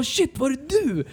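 high, drawn-out mock amazement; astonished onlookers. Locals realising the rider is the flyer, high astonished imitation.

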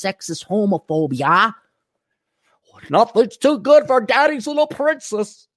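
hammed-up Minnesota accent, high and sing-song; mocking Minnesota accent. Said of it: "two fucking idiots from Minnesota like".

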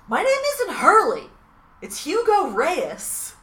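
Hurley correcting everyone, indignant and loud. Retold or imitated. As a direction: insist on your real name, loudly. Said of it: "and he's like".

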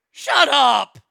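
loud, high shout; exasperated shout at the screen. Real, not acted. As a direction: yell it at her. Yelling at the claim.

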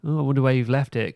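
ordinary talk, nothing marked

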